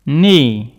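"Knee" loud, opening on N; silent K. Shown as a demonstration. Teacher repeats knee after explaining the spelling, silent K. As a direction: model "Knee" without sounding the K. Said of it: "On ne prononce pas le K".

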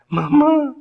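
high wailing call; a son calling out in tears; crying out. Retold or imitated. (llamado agudo y lloroso a la madre)

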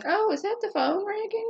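high, sweet fake-innocent voice; mock innocent. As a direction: play dumb in a sugary high voice. [name] acting innocent, mocking.

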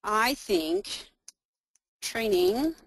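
halting, a few words at a time; broken up by long pauses; slow typing dictation. Real, not acted. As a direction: say it while typing it out. Reading aloud while typing dialogue.

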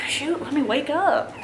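a hint of a groggy kid's complaint; voicing sleepy toddler. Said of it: "He's like".

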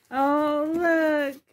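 high, loud happy squeal; real squeal of joy at a doll; excited. Real, not acted. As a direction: gush over the gift. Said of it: "Sorry if I'm loud".

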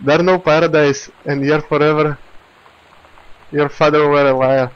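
put-on pirate captain taunting across the water in a pirate accent. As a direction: sneer it at the rival captain like a storybook pirate. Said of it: "I made this accent for a pirate".

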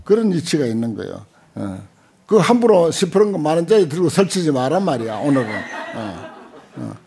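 normal talking voice

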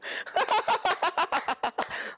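very high, drawn-out laugh; high cackle. Real, not acted. Streamer finding own pun hilarious, high cackle.

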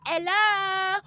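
high hello; high call. Acted. Hailing the viewers with a high hello. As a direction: toss it up high. Calling out.

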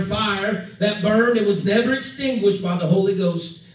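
ordinary delivery

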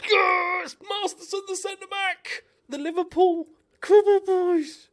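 very high, screamed cheer; ecstatic goal celebration. Real, not acted. Gamer celebrating Masterson's goal, ecstatic screaming. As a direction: scream the scorer's name in triumph.

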